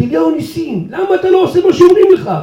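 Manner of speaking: mock angry rabbi yell; retold yell. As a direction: cry out in annoyance. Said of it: "הוא צועק צעקות".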